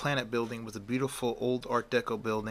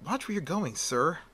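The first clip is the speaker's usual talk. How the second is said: higher-pitched mock indignation; mild scolding; 6 semitones higher than usual. Woman bumped by Clark, mildly scolding. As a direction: tell off a stranger in a higher voice. Woman snaps at man bumping her.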